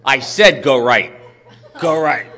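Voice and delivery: annoyed stranger repeating herself; perturbed repeat. Retold or imitated. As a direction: snap the order again, fed up. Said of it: "she seemed really perturbed".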